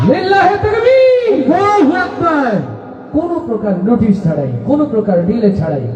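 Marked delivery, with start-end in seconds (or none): 0.1-2.5 takbir call. Real, not acted. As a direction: call the crowd to answer. Host leads crowd chant.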